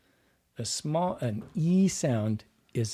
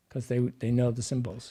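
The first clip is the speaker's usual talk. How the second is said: ordinary talk, nothing marked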